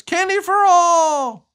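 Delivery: sign read aloud. Acted. Reading a sign in picture.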